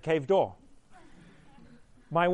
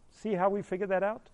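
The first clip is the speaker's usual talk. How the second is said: usual speaking voice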